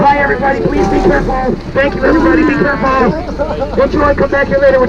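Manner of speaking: high call to a passing train; calling from far away. Real, not acted. Waving to people on train.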